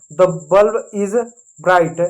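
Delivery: very slow and deliberate; slow model sentence. Shown as a demonstration. Teacher reading an example sentence to kids, slowly. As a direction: lay each word down for the class. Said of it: "तो bulb को bulb कहते हैं।".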